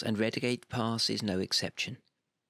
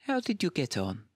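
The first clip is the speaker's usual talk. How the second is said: higher, innocently asking colleague; 6 semitones higher than usual. A narrator's reading. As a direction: ask it all innocence. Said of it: "in an innocent tone".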